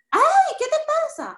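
sharp high yelp of offense, then snapping; startled angry outburst. Retold or imitated. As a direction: act out flaring up at being pushed. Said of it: "¿Tengo una reacción fuerte?". (grito ofendido y brusco)

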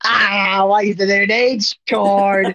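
drawn-out, hammed-up crying shout; mock wailing. Joking about the missing H chord, fake wailing. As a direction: wail it slowly as a joke. Mock lament after "so sad.

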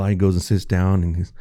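ordinary delivery

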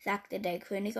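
usual speaking voice

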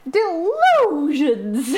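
put-on slow, high Southern American accent; Robert Pattinson's Southern American accent; Southern drawl. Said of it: "there's just a bit where he goes".

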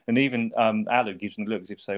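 normal talking voice

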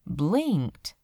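ordinary delivery

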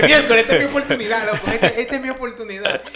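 put-on loud, high, thrilled kid; excited. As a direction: gasp at the big chance. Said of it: "yo dije".

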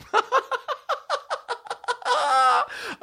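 a long high cackle of delight; high laugh. Real, not acted. Comic laughing at his own prank, high cackle.

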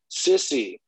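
put-on mildly whiny kid telling off his sister; imitated sleepy-kid complaint. Son woken at night by his sister, mock complaint.

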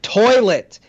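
high, loud insistent repeat; imitated yelling kid. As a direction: yell it as a frustrated pupil would. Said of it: "kids yelling the word toilet".

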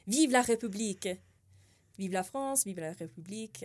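put-on French-accented rally cry; French phrases. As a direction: proclaim it in French. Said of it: "I, I say".

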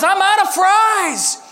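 high, loud, drawn-out holler; retold yell. Supervisor calling out across the kitchen, drawn-out mock yell. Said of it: "Gabe yells out".

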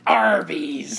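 shouted pirate punchline. Acted. Answering a pirate riddle, shouted pirate voice. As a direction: hit it hard like a pirate's arr. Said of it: "Where do pirates go to eat".

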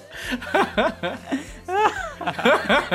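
high laugh that keeps going; long high laugh. Real, not acted. Still laughing at joke.

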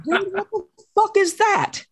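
high, shocked what the fuck; recoiling at a friend's slur; shocked retort. Retold or imitated. To a classmate at lunch, a shocked high outburst.

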